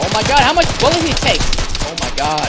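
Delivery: loud, fast, high disbelief; shocked outburst. Real, not acted. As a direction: yell it in total disbelief. Stunned enemy survives many shots.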